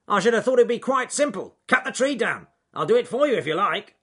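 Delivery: high, loud bossy beaver voice; brusque know-it-all beaver; bad-tempered beaver. Beaver offering to cut the tree down, brusque and loud.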